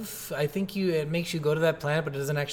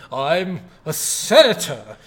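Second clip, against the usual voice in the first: slow, loud, self-important drawl; pompous senator; 6 dB louder, much slower than usual. Retold or imitated. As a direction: stretch it out with mock grandeur. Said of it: "like, that pompous attitude".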